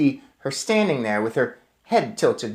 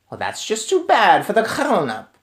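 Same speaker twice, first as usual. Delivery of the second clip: raised witch voice, scornful; a hint of a cold witch queen; Grand High Witch; 7 semitones higher than usual. Witch queen scorning the child, mock-cruel. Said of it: "said the Grand High Witch".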